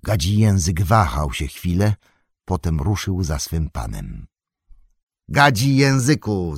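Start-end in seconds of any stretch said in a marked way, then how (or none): 5.3-6.6 higher, louder call. Acted. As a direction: call him back. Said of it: "zawołał Frodo".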